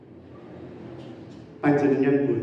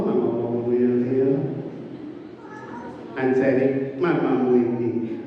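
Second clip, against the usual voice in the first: tender cooing mother; 5 semitones lower than usual. Retold or imitated. Mother cooing over sleeping child.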